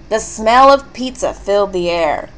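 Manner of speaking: usual speaking voice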